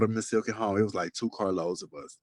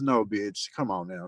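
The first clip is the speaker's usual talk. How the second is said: ordinary talk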